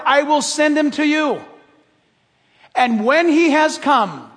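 loud, measured Bible reading; scripture reading. Acted. Pastor reading John to the church in a loud, measured read. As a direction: read it out like holy text.

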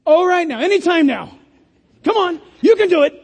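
high, loud coaxing; playful cheering-on from someone waiting to be asked; Jesus urging. Acted. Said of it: "And Jesus is saying".

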